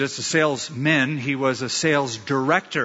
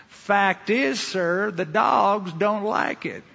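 higher, drawn-out Southern voice; Southern salesman; 5 semitones higher, much slower than usual. Acted. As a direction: deliver it slowly as the salesman from the American South. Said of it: "one of the salesmen from the South".

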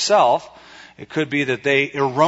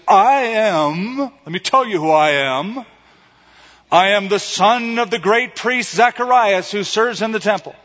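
proud boast; 6 semitones higher, 4 dB louder than usual. Retold or imitated. John imagined boasting of lineage.

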